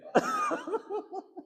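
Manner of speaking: very high giggling laugh. Real, not acted. Listener laughing at the answer, high giggle. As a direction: burst into a giggle.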